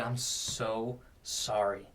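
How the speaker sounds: slow, soft, low apology; retold heartfelt apology. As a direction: apologise quietly, drawing out the words. Retold apology to bride and groom.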